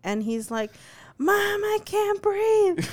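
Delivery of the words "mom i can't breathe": put-on high, drawn-out panicked kid voice; little kid wailing for mom, imitated; imitated child's scream. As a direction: mimic a screaming toddler, high and slow. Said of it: "Axel comes screaming".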